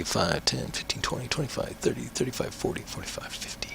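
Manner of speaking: counting under breath. Real, not acted.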